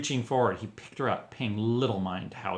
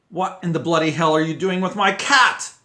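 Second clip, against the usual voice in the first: bellowing across the dining room; loud outraged shout; 7 semitones higher, 10 dB louder than usual. A narrator's reading. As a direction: shout it out, furious. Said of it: "a loud voice rang out".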